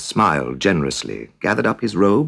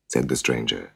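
plain reading voice

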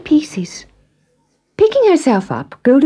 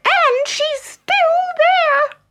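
put-on very high squeaky baby bear squeal; tiny bear cub squeaking in dismay; much slower than usual. A narrator's reading. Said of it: "squealed Baby Bear".

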